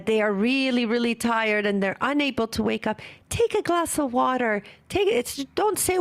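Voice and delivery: ordinary talk, nothing marked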